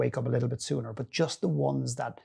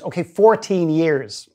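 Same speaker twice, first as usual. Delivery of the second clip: slow, louder repeat; louder on request; 4 semitones higher, 7 dB louder, slower than usual. Retold or imitated. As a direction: say it again, louder and deliberate. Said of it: "can you say it louder".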